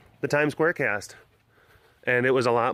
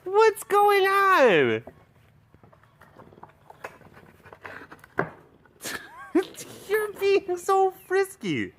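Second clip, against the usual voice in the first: squealing pet talk; slower than usual. Real, not acted. Owner calls the frisky cat down, high drawn-out squeal.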